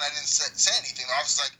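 ordinary delivery

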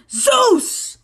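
high, ringing announcement; grand shout. Acted. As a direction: call out his name like a herald. Announcing Zeus.

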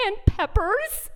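drawn-out mock toddler reply; lightly voiced toddler. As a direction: lightly imitate a little boy adding to his list. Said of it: "And then he says".

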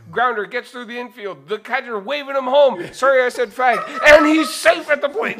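high, loud play-by-play building to a shout; baseball voice. Acted. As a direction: call it like a wildly excited announcer. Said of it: "Imagine the baseball voice saying that".

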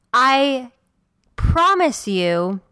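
ordinary talk, nothing marked

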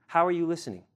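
ordinary talk, nothing marked

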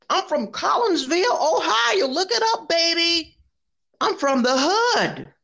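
high, sassy mock voice of a coworker. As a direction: sing it out high and sassy, mocking her. Mocking coworker's claim.

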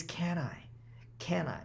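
breathy whisper of self-doubt. Pretend. Whispering the nagging doubt to yourself. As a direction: hush it like a nagging fear. The question that plagues us.

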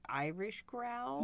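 slow, drawn-out joke Irish growl; mock Irish growl. Cohost offering a different growl instead of accents, mock Irish growl. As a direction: play at growling in Irish, slowly. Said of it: "do a different growl?".

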